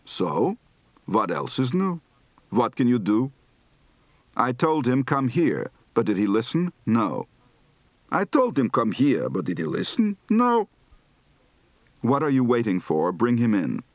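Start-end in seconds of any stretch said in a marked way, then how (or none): 0.1-3.3 slower, drawn-out Yiddish lilt, "can" rising; Yiddish shrug intonation. Shown as a demonstration. Teacher demonstrates resigned Yiddish tune, drawn out. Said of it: "Recite the following practice phrases". 8.1-10.7 exaggerated Yiddish intonation. Shown as a demonstration. Teacher demonstrates complaining Yiddish tune, big jumps. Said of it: "Recite the following practice phrases".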